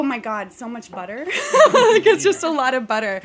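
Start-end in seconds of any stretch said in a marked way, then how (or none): 1.3-3.2 loud laughing confession. Real, not acted. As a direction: admit it through a laugh. Laughs about the butter secret.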